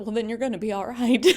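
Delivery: laughing through the punchline, pitch up; real laughter breaking into the retold line. Real, not acted. Mother retelling the minister's reassurance to her daughter, laughing.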